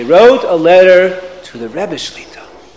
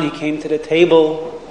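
usual speaking voice

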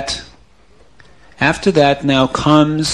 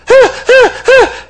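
sly high laugh; 7 dB louder than usual. Acted. Brother laughs over body.

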